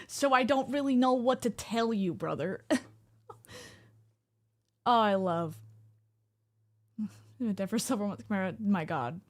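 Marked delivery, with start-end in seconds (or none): none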